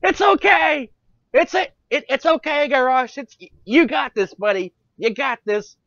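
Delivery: high, loud cheering, hammed-up; mock encouragement. Thrall cheering Garrosh before leaving him in charge, hammed-up shouting. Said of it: "and you're like".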